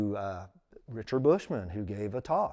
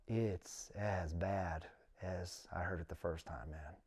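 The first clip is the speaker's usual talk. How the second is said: inner voice; 5 semitones lower, 11 dB quieter, slower than usual. Retold or imitated. Thinking to himself, listening quietly.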